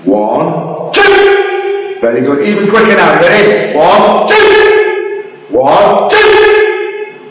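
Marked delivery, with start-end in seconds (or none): 0.9-2.0 sharp, high drill shout; loud shout. Shown as a demonstration. Instructor cueing a downward block, loud shout. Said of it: "And a big shout". 4.3-5.3 sharp, high drill shout; loud shout. Shown as a demonstration. Instructor cueing a downward block, loud shout. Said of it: "And a big shout". 6.1-7.3 sharp, high drill shout; loud shout. Shown as a demonstration. Instructor cueing a downward block, loud shout. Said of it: "And a big shout".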